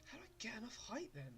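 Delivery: fast muttered whisper. Real, not acted. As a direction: whisper it under your breath.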